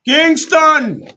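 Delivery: high, loud call of the name; hype shout-out. Acted. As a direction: belt the name like a hype man. DJ shouting out a listener.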